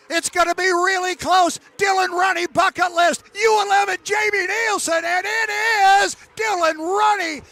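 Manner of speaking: high and loud, building to the line, then easing off; shouting race call. Real, not acted. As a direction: call the finish at full voice. Calling the photo finish.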